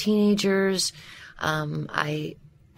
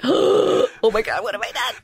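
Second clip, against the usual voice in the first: higher, dismayed retold panic; mock panic; 4 semitones higher than usual. Retold panic after clicking send.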